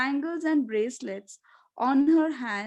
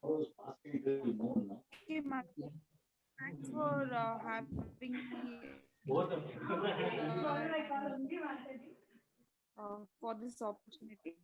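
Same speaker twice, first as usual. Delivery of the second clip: faint, far from the mic; barely audible, much softer; very quiet; 11 dB quieter than usual. Real, not acted. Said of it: "your voice is very low".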